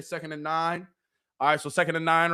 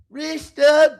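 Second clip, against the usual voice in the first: loud call; 10 semitones higher, 11 dB louder than usual. Real, not acted. Calling out to chat.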